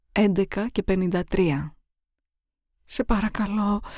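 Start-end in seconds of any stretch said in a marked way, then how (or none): 2.8-3.8 hushed, frightened begging; barely dares to ask; a quiet first please; timid plea. Acted. Captive begs her killer.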